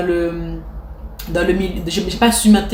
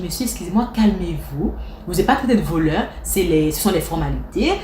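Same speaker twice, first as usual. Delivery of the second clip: ordinary delivery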